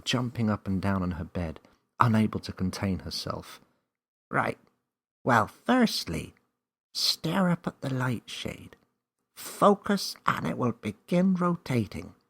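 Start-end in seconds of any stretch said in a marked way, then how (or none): 4.3-12.1 higher, lighter voice of a small magic creature; small wise creature explaining the rules; magical helper creature. Acted. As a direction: play a confident little magical guide. Name gives instructions.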